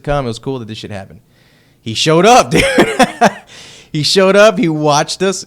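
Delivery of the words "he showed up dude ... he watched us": thrilled, laughing and much louder; can't believe it, laugh it out; laughing excitement. Real, not acted. Telling a friend the label guy came, laughing with joy.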